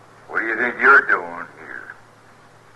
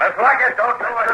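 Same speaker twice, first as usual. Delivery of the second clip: urgent shout; 12 semitones higher than usual. Acted. Marshal yelling to stop the wife from shooting, high and urgent. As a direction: shout to stop her before she fires.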